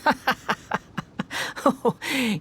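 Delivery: high, scornful burst of laughter; mocking laugh. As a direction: laugh down at them. Scaramouche mocks their naivety.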